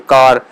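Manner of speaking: کار said the Urdu way with an open long aa; Urdu pronunciation. Shown as a demonstration. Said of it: "Urdu میں ہم بولیں گے تو کار بولیں گے".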